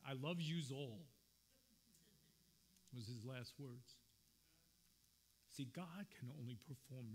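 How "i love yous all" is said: imitating a father's Brooklyn accent; lightly put-on Brooklyn accent, heartfelt. Son retells father's last words, in Brooklyn accent. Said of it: "let me say it in his Brooklyn, his Brooklyn accent".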